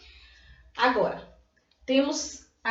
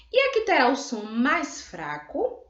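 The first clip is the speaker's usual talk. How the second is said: usual speaking voice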